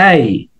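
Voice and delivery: এই as a gliding diphthong, not a flat এ; correct letter A. Shown as a demonstration. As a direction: say এই, sliding from e to i. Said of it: "এর উচ্চারণ আসলে এ না".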